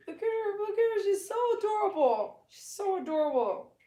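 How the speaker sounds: very high-pitched doggy voice. Real, not acted. Owner fussing over an adorable new dog, baby talk. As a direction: coo and call to the dog way up high. Coos and calls to her dog.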